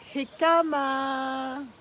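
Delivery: Jicama said very slowly, stretched, HIC-a-ma; drawn-out jicama. Shown as a demonstration. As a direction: savour the word Jicama slowly. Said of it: "The accent is on the hic".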